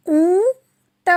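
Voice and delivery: ऊंट stretched very long and nasal; drawn-out title word. Shown as a demonstration. Teacher announcing poem title to children. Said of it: "कविता का शीर्षक है".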